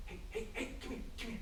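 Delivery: intense hushed call, pitched high; urgent shout held down to a whisper; whisper yelling. Shown as a demonstration. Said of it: "whisper shouting thing".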